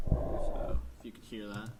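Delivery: thin radio filter sound; tinny voice through a radio speaker. Shown as a demonstration. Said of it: "the sound sounds like it's coming from a radio".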